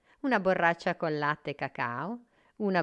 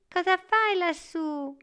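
put-on high-pitched boy asking upward; curious boy; 13 semitones higher, 3 dB louder than usual. A narrator's reading. Boy to a star stuck in a tree, curious. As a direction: voice a wondering child, pitched well up.

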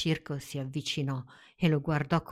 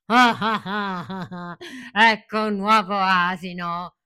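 loud laughing shout of a cruel master; gloating shout; 3 semitones higher, 8 dB louder than usual. Acted. The circus man bursts in on the donkey, loud gloating laugh. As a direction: bellow with cruel glee. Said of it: "un uomo spalancò la porta e urlò".

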